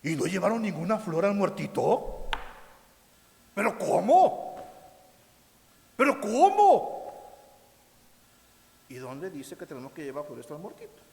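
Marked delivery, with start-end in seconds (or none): 0.0-4.6 mock shock. Mock outrage over missing flowers. 6.0-7.3 mock outrage.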